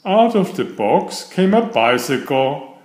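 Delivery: ordinary delivery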